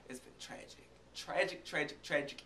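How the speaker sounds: breathy whisper of mock tragedy; whispered tragic. Telling viewers about being sick, in a mock-tragic whisper. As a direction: whisper it like a sad confession.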